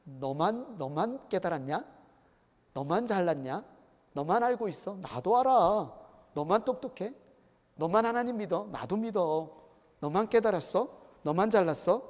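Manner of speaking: acted peevish one-upping; indignant retort. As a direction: needle a know-it-all, question after question. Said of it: "한마디로 말하면 이런 말이죠".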